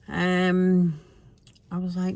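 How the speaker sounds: ordinary delivery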